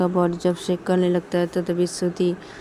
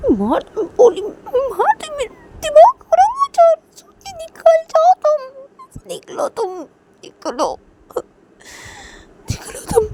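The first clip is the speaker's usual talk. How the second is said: high, loud, tearful screaming; losing control, sobbing out the orders; crying shriek; 5 dB louder than usual. A narrator's reading. Shreya breaks into crying shrieks. (रोते हुए ऊँची चीख़)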